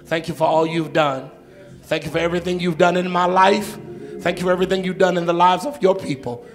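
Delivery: loud preacher's closing prayer, building; full-force preaching. Real, not acted. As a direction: lead the church in praise at full voice. Closing prayer and praise call.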